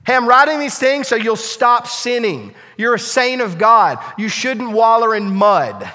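loud, high sermon paraphrase; passionate paraphrase. Acted. Preacher paraphrases the apostle to the church, loud and high. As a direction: urge the saints earnestly.

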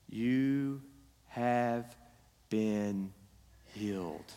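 slow, hushed scripture read. Acted. Pastor reads the verse to the congregation, hushed and slow. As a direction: let the verse settle softly.

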